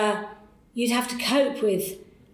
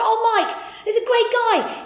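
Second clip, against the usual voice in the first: much higher, louder rushed enthusiasm; eager pitch; 13 semitones higher, 5 dB louder than usual. Retold or imitated. Researcher pitching to boss on the run, high eager gush. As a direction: gush the pitch while running behind him.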